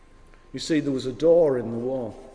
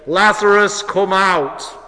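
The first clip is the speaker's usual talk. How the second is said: loud, raised summons; calling out; 3 semitones higher, 8 dB louder, slower than usual. Acted. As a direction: call someone out of a tomb. Jesus calling into the tomb.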